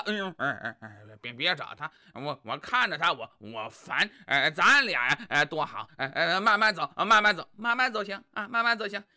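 low, halting, full of er-er; stammering dullard husband. Acted. Husband making excuses to his wife, low halting stammer.

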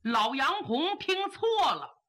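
ordinary delivery

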